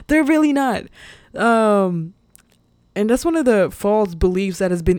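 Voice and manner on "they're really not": emphatic protest. Real, not acted. Emphatic rebuttal, laughing.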